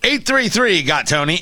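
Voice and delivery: ordinary delivery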